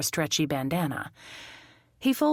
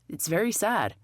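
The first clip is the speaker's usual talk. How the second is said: ordinary delivery, nothing marked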